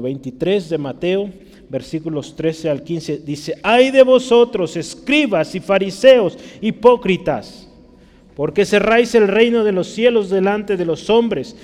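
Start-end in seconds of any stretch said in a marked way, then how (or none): 3.6-11.6 forceful rebuke. Acted. Preacher reading Jesus' words against scribes and Pharisees, forceful denunciation. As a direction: pronounce the woe strongly, high and loud. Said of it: "él habló fuertemente de estos".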